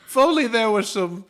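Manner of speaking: high, loud hinting plea; hint hard that they should help; pleading goblin. Acted. Goblin fishing for help from strangers, high and pleading.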